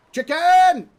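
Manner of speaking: shouted high and loud; excited shout. Real, not acted. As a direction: yell it out in sudden excitement. Spots chicken during gameplay.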